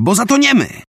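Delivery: high, urgent commanding shout. A narrator's reading. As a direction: order everyone to the middle. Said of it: "krzyknęła". (wysoki, naglący okrzyk rozkazu)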